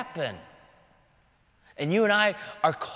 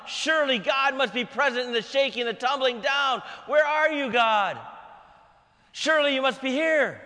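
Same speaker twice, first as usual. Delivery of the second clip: shouting for God; 5 semitones higher than usual. Acted. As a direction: call out over the crashing earth. Elijah shouting amid the earthquake.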